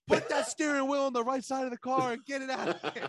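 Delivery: loud, high comic impression of a bossy man; hammed-up exasperated yell; mock irate order. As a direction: holler the order like an exasperated movie character. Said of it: "And he's like".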